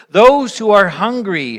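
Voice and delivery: ordinary talk, nothing marked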